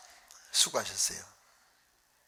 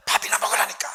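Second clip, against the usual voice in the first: higher, louder, breathy and unvoiced, pushing it away; impatient insistence; 7 semitones higher, 6 dB louder, faster than usual. Senior pastor telling the nosy preacher to just eat, impatient breathy insistence.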